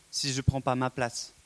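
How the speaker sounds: usual speaking voice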